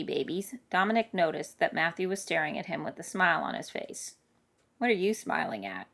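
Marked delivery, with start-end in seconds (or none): 4.8-5.9 low, understated grumble; mildly grumpy mutter; grumbled. Acted. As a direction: grumble it lightly, pitched down. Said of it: "he grumbled".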